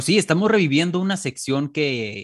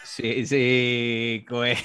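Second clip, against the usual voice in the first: usual speaking voice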